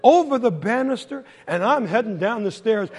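ordinary delivery

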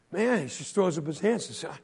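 ordinary delivery, nothing marked

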